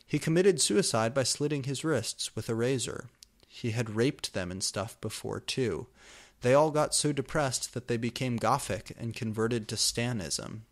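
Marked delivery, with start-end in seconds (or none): none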